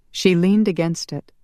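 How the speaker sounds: ordinary delivery, nothing marked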